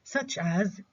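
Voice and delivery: normal talking voice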